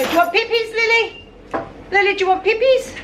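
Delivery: high, sing-song voice for the dog; talking to the dog. Real, not acted. Calling the dog to play.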